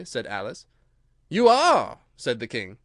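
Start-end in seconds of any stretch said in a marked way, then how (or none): 1.3-2.0 high, loud retort; emphatic royal contradiction; contradicting. Acted. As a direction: insist on it as king. King contradicts Alice in court.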